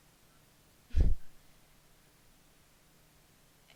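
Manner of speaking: a long booming cackle; loud cackle. Acted. Villain cackling before turning on Equius, loud. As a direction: throw back your head and cackle loudly.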